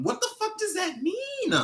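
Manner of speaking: very high-pitched, thin cry of disbelief; incredulous squeal. Real, not acted. Incredulous outburst at threat.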